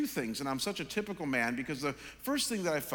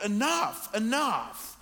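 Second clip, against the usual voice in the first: enough said higher and louder, falling hard each time; emphatic enough; 6 semitones higher, 4 dB louder, much slower than usual. Retold or imitated. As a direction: slam down each enough like a verdict. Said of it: "I just wrote".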